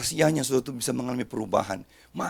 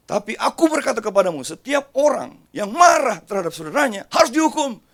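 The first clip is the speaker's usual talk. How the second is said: loud, high proclamation of judgment; stern shout; 7 semitones higher, 8 dB louder than usual. Acted. Pastor proclaiming Jesus' warning to the church, shouting. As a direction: thunder the warning at the congregation.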